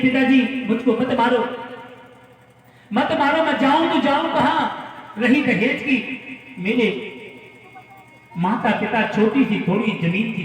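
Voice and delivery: high-pitched begging; imitated desperate pleading. Daughter-in-law begs in-laws not to beat her, pleading. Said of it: "बार बार पति के चरणों में गिर जाती है".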